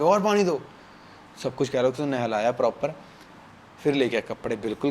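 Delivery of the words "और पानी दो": put-on drunk demand. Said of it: "वो गाली दे रहा है".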